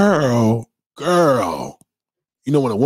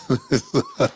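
high laugh. Real, not acted. Breaks out of sketch.

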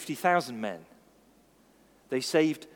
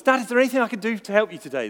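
put-on eager little boy; 6 semitones higher, 6 dB louder, much faster than usual. Little boy voiced in story.

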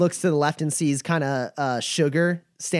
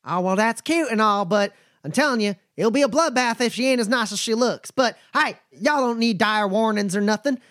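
higher, folksy drawling caravan hand; a wary but friendly trail boss sizing folks up; nomad Bumper; 5 semitones higher, faster than usual. Acted. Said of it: "and goes".

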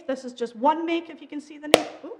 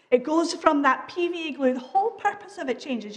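ordinary talk, nothing marked

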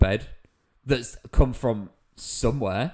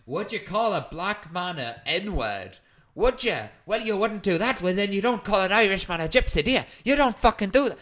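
angry Irish guy; 5 semitones higher, 3 dB louder than usual. Retold or imitated. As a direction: imitate a furious man leaning in to confront. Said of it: "but he's going".

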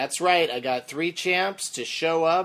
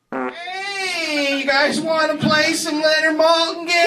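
high loud theme park announcer; ride announcer; 10 dB louder than usual. Acted. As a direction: shout it like a ride barker. Ride announcer bit on recording.

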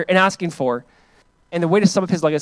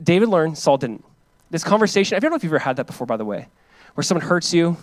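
normal talking voice

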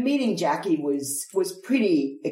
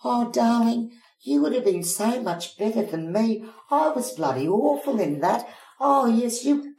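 higher, gushing gracious friend; lightly voiced gushing actress; gracious darling; 6 semitones higher than usual. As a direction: warmly wave the compliment away. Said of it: "her response was".

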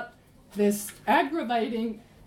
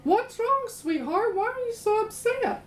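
high, sugary coaxing wife; a sweet high wife's voice asking her pouting husband what's wrong; Jezebel coaxing; 8 semitones higher than usual. Retold or imitated. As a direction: coo it up high like a doting wife. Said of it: "She snugs up to him".

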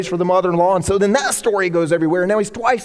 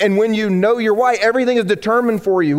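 normal talking voice

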